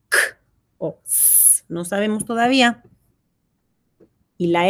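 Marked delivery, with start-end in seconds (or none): 1.0-1.6 a short unvoiced hiss for the soft C; just breathe out a quiet s. Shown as a demonstration. Teacher showing the C's second sound, soft hissing. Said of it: "La C tiene dos sonidos".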